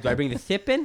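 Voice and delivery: "chip" lisped into "thip"; lisping. Retold or imitated. Asking the dentist about the broken piece, lisping. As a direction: lisp the question, "tip" said as "thip". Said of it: "I'm, like, lisping".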